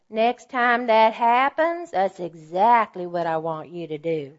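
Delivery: slow, drawn-out imitation of an Atlanta accent. Eighty-three-year-old mentor instructing her disciple, imitated Atlanta accent. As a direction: put on an older mentor's Atlanta accent, taking your time. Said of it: "she said in her Atlanta accent".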